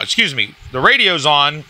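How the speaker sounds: ordinary talk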